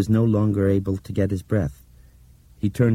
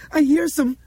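high, loud, urgent alarm; sharp cry; 5 dB louder than usual. Acted. Dinah hears danger and is cut short, sharp cry. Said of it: "Dinah cried sharply".

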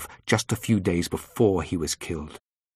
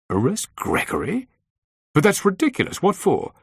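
high, rising, loud disbelief; incredulous; 13 semitones higher, 4 dB louder than usual. A narrator's reading. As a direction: let the voice leap up in disbelief. Partner incredulous at the arrest.

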